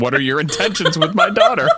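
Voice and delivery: high, loud mock growl of a protective dad, laughing; deep gravelly dad voice. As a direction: ham up a gravelly father, laughing through it. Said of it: "In that deep, gravelly voice".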